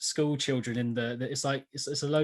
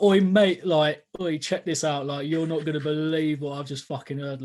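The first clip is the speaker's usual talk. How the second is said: loud mock British call of oi mate; imitating his teenage London accent, excited; shouting to a mate in the school toilets, London accent; excited London lad; 2 semitones higher, 4 dB louder than usual. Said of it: "I was like".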